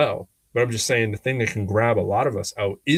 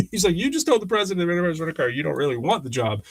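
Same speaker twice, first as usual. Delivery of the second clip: amused disbelief; 7 semitones higher than usual. Retold or imitated. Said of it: "laughing at the table".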